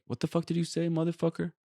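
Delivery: mock confrontation. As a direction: act out picking a fight, held back in volume. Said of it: "and be like".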